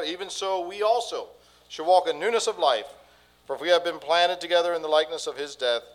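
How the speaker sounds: loud, proclaiming King James verse; fervent scripture reading. Acted. Pastor reading Romans six to the church, proclaimed loudly.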